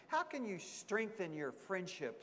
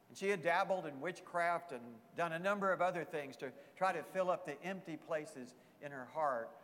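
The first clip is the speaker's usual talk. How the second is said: ordinary talk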